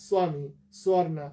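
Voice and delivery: স্বামী and স্বর্ণা with sw, swami and swarna; Hindi-style w sound. Shown as a demonstration. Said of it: "বফলার উচ্চারণ ডব্লিউ এর মতো". (স্বামী, স্বর্ণা, ব-ফলা ওয়া করে)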